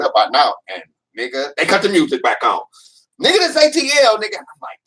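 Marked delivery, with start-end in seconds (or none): 3.2-4.4 high, loud hometown chant; mock crowd shout. Crowd reps Atlanta as the music comes back on, rowdy shout. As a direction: imitate a rowdy crowd bellowing its city.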